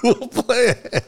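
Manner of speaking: high, drawn-out mimicry; mocking echo. Mocking the golfers who left them behind, high mocking echo. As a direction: parrot the excuse in a stretched, high voice.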